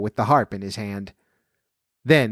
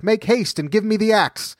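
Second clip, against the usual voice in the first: higher urgent plea; urgent call; 9 semitones higher than usual. A narrator's reading. Jack begging mother for the axe, urgent high call. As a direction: hurry her, voice pitched up. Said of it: "cried Jack".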